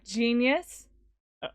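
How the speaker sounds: ordinary delivery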